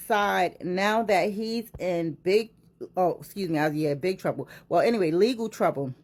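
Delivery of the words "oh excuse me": ordinary talk, nothing marked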